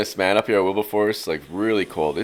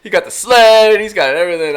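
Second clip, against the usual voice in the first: higher and louder with each item; building excitement; 10 semitones higher, 9 dB louder than usual. Real, not acted. Host listing the gear his buddy packed, rising hype. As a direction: pile it on, getting more pumped.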